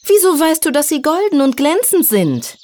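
high, astonished questioning; suspicious challenge. A narrator's reading. Fairy challenges the snake's knowledge, astonished and suspicious. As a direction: demand an explanation in a high voice.

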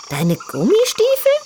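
put-on high, vain young piglet voice; girly piglet voice. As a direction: play a proud little diva pig. Tütü asks.

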